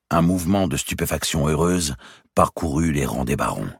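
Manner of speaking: usual speaking voice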